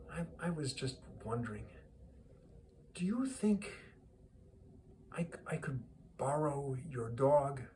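soft, halting, tentative question; hesitant request. Acted. Businessman asking a mourner for his dog, softly and hesitantly. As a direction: ask delicately, with long pauses.

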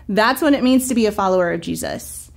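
usual speaking voice

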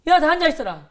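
loud bullying command to the class; barking orders. Retold or imitated. Schoolboy bursting into a classroom, loud order. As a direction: shout them down like a schoolyard boss.

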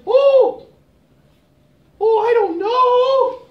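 high, slow, unsure giraffe voice; hesitant giraffe. Acted. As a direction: squeak it out nervously, drawn out.

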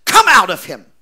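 sudden loud command; commanding shout. Retold or imitated. Preacher commands the devil out of the boy, shouting. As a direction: cast it out with force. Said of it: "I looked at him and said".

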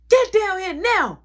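loud, high, drawn-out yell; mother's angry summons. Retold or imitated. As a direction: holler upstairs as an angry mom. Mother calling the teller downstairs angrily.

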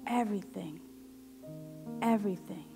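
ordinary talk, nothing marked

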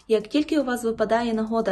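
normal talking voice

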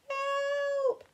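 scared animals shout for help; frightened cry. Acted. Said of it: "cried the animals".

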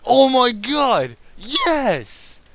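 high thrilled shriek, voice cracking; yelling with joy, voice breaks; excited scream with voice crack. Real, not acted. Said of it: "Did you hear that voice crack in there?".

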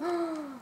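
high, soft gasp of surprise; sharp intake of breath at a find; excited little gasp; delighted gasp. Real, not acted. Finds lipstick in box.

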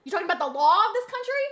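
rising loud questioning, "law" with an open aw; incredulous retort. Retold or imitated. Streamer mocks her teacher's pronunciation, incredulous loud retort. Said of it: "And I'm like".

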